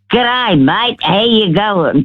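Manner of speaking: Aussie accent. Shown as a demonstration. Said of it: "I'll talk".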